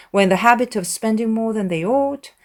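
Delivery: ordinary delivery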